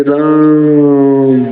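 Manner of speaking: राम with the आ stretched very long, slow; राम drawn out as if calling someone from afar; prolonged call. Shown as a demonstration. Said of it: "तो र पर बहुत ज़्यादा समय दे रहे हैं".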